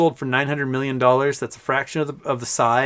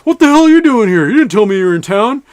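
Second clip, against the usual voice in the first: loud, high-pitched acted-out outburst; mock indignant shout; 8 semitones higher, 10 dB louder than usual. Dad surprised to find his son on the ferry, mock indignant shout. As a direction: imitate a dad loudly accosting his son.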